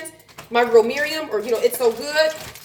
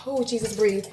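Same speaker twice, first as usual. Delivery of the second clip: quiet prayer; 3 semitones lower, 6 dB quieter than usual. Real, not acted. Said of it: "I'm so stressed".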